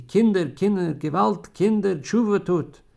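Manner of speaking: put-on loud, high desperate plea to the children; anguished outcry. Dying klezmer father calls out to his children, loud and pleading. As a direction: cry out to them to repent.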